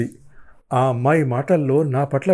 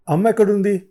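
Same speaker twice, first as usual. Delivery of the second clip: urgent demand; 8 semitones higher, 4 dB louder, faster than usual. Acted. Narrator urgently demands mother.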